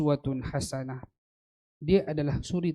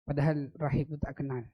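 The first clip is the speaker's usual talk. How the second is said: ordinary delivery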